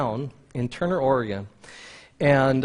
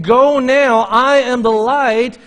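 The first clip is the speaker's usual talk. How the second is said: louder, higher, pressing preacher's call; urgent preaching; 7 semitones higher, 6 dB louder, slower than usual. Acted. Pastor urging listeners to leave sin now, full-force preaching. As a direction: urge them to go now.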